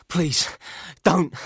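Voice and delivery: high, loud desperate cry; terrified begging. A narrator's reading. Man with a gun at his groin cries out, high and terrified. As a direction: plead for your life, voice cracking upward.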